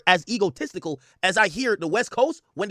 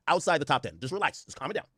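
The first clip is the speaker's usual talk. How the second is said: usual speaking voice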